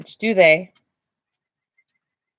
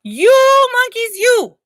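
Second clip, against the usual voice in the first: high, loud, slow scolding; angry peddler; 14 semitones higher, 7 dB louder, slower than usual. Acted. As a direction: shake a finger and scold the monkeys. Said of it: "shaking his finger at them".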